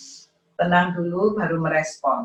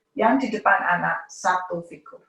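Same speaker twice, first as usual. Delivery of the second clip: usual speaking voice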